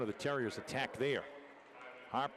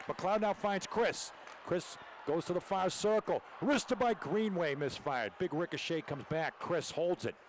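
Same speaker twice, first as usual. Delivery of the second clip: higher-pitched, urgent hockey call; excited play-by-play; 5 semitones higher, 3 dB louder than usual. Real, not acted. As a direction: ride each scoring chance with rising urgency. Live call of scoring chances.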